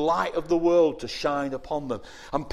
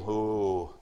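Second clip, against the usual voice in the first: ordinary talk, nothing marked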